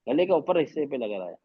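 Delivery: usual speaking voice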